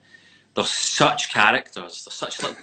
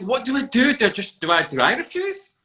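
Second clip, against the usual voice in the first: high, louder, frantic questions; panicked; 13 semitones higher, 6 dB louder than usual. Retold or imitated. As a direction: play the panic of not knowing what to do. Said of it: "I'm like".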